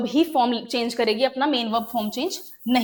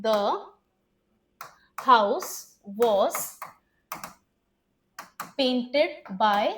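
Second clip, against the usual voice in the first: slow dictation; much slower than usual. Shown as a demonstration. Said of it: "dictating word by word".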